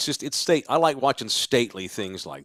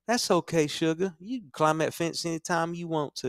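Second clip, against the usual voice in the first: put-on Dixie accent; 7 semitones higher, 4 dB quieter than usual. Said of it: "that Dixie accent".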